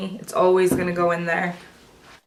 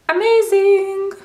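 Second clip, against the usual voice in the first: high drawn-out amazement; excited; 11 semitones higher, 5 dB louder, slower than usual. Real, not acted. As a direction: stretch it out, thrilled. Tasting the guacamole, exclaims.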